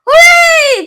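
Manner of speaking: high, loud delighted cheer. A narrator's reading. Wonka cheers as the boy is rescued. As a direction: celebrate the rescue. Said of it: "cried Mister Wonka".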